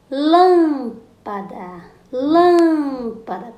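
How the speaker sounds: slow drawn-out call on LÂM of "lâmpada"; calling out the word. Shown as a demonstration. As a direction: call out "Lâmpada", holding LÂM, then let "pada" drop. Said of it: "Quando você chama a palavrinha lâmpada".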